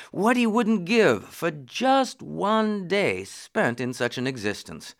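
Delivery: ordinary delivery, nothing marked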